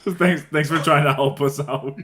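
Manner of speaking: slow thanks through laughter; laughing sarcastic thanks. Real, not acted. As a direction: laugh while mockingly thanking him. Mock thanks after unhelpful answer.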